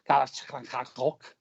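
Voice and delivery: throaty-k Scouse catchphrase accent. Shown as a demonstration. Showing off his only Scouse phrase, Scouse accent. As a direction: do the one Scouse phrase you can manage. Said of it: "I can't do a Scouse accent apart from".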